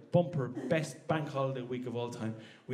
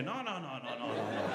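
dismissive objection; 4 semitones lower, 6 dB quieter than usual. Acted. Irish objector cutting in.

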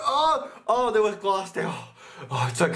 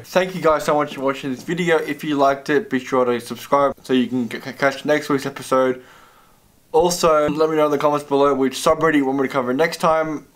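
louder, upbeat sign-off to camera; outro announcer read; 2 semitones higher, 6 dB louder than usual. Acted. Vlogger signing off to viewers, loud upbeat host read. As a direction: wrap the video up like a host closing the show.

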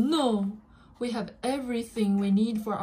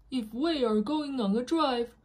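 plain reading voice

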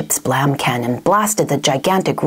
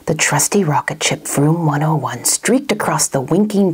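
ordinary delivery

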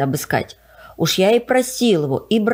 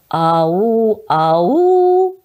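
эхо; 2 semitones higher, 4 dB louder than usual. A narrator's reading. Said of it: "повторило эхо".

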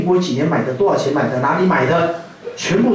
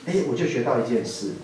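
ordinary delivery

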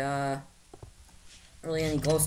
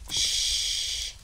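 shushing. Acted.

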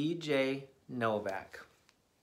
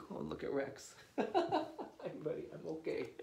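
soft breathless laughing words; laughing aside; 9 dB quieter, faster than usual. Real, not acted. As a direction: giggle it out quietly.

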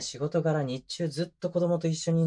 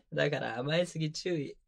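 usual speaking voice

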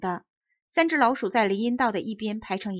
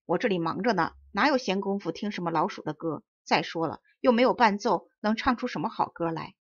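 ordinary delivery, nothing marked

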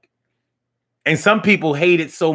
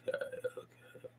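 whisper; 24 dB quieter than usual. Shown as a demonstration. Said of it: "whispered okay".

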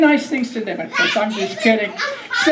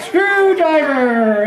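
normal talking voice

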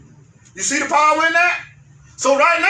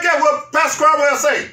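usual speaking voice

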